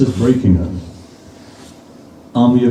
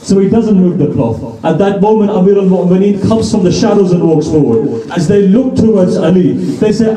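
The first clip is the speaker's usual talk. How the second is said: raised-pitch, fervent sermon storytelling; impassioned preaching; 7 semitones higher than usual. Acted. Preacher reveals the masked young warrior to the congregation, impassioned. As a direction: build to a proud, rousing reveal.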